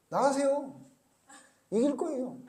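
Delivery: high, lilting mock reassurance; mock sweet high. Prophet mockingly echoing the false prophets to the king. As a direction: sing it sweetly, almost teasing. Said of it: "이렇게 얘기했어요".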